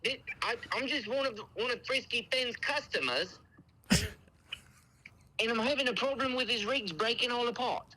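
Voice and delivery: high, put-on British complainer; British accent. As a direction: fake aggrieved customer with a British accent. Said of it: "the best British accent I've ever heard".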